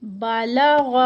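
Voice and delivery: بالغ with light ba and lam, heavy full غ; Quranic recitation. Shown as a demonstration. Teacher modelling recitation of the word. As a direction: recite بالغ, letting the غ sound thick. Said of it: "غین بھی جو ہے وہ heavy letter ہے".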